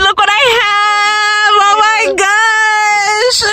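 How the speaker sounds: high, slow, drawn-out cheer; delighted announcing the arrival; excited arrival. Real, not acted. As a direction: stretch it out, thrilled to land. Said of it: "so excited".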